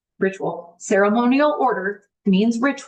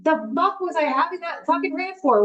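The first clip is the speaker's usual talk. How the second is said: frustrated self-scolding; 6 semitones higher than usual. Retold or imitated. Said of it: "And I'm like".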